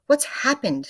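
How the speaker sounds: high, loud alarmed cry; scream. A narrator's reading. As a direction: cry out in a panic. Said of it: "screamed Missus Twit".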